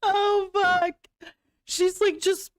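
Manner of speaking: laughing. Real, not acted. Cracking up at clip.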